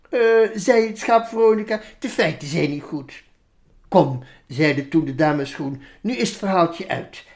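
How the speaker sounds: put-on lively, sing-song reading of a children's poem. Reading the Veronica poem aloud for the interviewer, animated. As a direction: read it out like a storyteller to kids.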